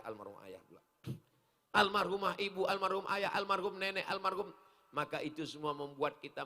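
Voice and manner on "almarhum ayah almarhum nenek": ordinary talk, nothing marked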